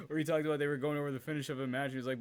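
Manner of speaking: normal talking voice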